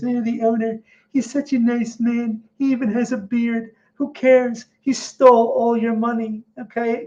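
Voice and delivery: high, mocking voice of a gullible fan; mocking a gushing fan. Trader mocking hyped investors, high sneering voice. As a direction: parody the starry-eyed crowd praising the owner.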